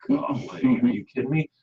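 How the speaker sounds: exasperated disbelief. Retold or imitated. Coming home to barking dog.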